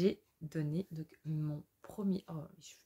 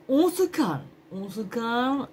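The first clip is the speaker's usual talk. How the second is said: high and loud, calm down; excited; 7 semitones higher, 12 dB louder, slower than usual. Real, not acted. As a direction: cry out to calm yourself. Telling herself to calm down.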